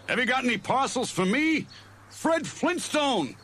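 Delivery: eager cartoon yell at the postman; Fred shouting. A narrator's reading. Fred calls out to the postman, high eager shout. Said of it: "he shouted".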